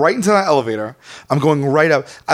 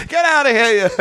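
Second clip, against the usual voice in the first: high, put-on shooing call; hammed-up send-off; mock holler; 8 semitones higher than usual. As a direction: send the car off in a raised pitch. Imitates friend shooing the cab off.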